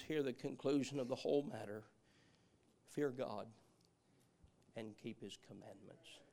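low, hushed preaching with long pauses; quiet, measured, reverent delivery; solemn sermon close. Real, not acted. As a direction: bring it down soft and grave.

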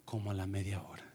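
very low, very soft; hushed suspense. Real, not acted. Speaker telling of trouble returning half an hour later, hushed. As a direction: drop nearly to a murmur, dreading what comes.

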